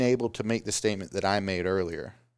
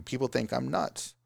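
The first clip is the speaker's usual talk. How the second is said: ordinary delivery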